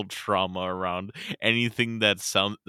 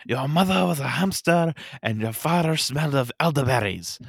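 higher, hammed-up French accent; mock French insult, raised voice; 5 semitones higher, 4 dB louder than usual. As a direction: taunt in a put-on French accent. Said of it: "Can you do a French accent?".

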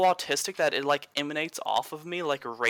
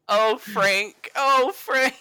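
higher, breathless pretend longing for Frank; mock swooning; 6 semitones higher, 4 dB louder, much slower than usual. Teasing friends about the Pope, a mock lovestruck sigh. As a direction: ham up a lovestruck sigh of the name.

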